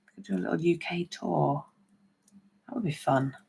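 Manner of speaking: a hushed little idea shared with the viewers; whisper. Real, not acted. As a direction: whisper it like a secret plan. Daydreaming about a UK tour.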